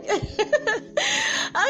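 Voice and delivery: high giggly laugh. Real, not acted. Host after jokingly selling herself to listeners, giggling.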